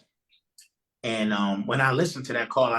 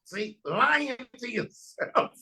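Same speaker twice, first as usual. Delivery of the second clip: knowing minister; 10 semitones higher, slower than usual. Retold or imitated. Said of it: "That's first line number one".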